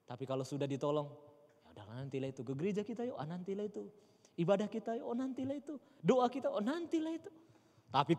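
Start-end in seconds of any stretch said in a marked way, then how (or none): 1.6-7.3 lazy brush-off. Retold or imitated. Believers brushing off church.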